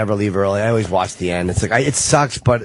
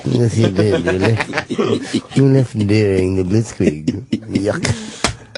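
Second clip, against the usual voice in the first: mock British accent; 4 semitones lower, much slower than usual. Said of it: "be like a British woman".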